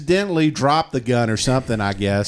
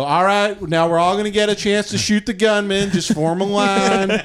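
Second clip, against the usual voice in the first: higher, lightly voiced church leader; hint of a preacher herding the flock; mock pastor announcement; 6 semitones higher than usual. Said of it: "they go".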